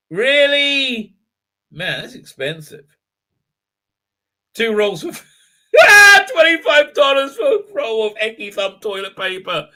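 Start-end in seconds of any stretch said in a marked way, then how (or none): 5.1-9.7 laughing. Real, not acted. Laughs at the price.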